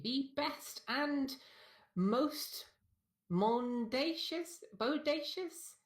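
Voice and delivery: high, slow hyped-up outro; oversold announcer. Acted. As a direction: sell the sign-off way too hard. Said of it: "you kind of oversold it".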